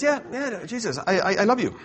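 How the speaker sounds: high-pitched, stammered insistence; protesting Peter. Acted. Disciple answering Jesus's question about love, high eager protesting. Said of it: "Peter says the same".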